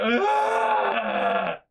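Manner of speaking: hammed-up shriek over a bee sting; mock anguished yell. Sister calling the house, mock-yelling in pain. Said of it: "It was like a".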